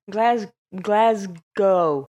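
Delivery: "Glasgow" drawn out very slowly, "Glas" stressed, ending in a falling tone; slow careful pronunciation. Shown as a demonstration. Host practising the city name, very slowly. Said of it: "I still s".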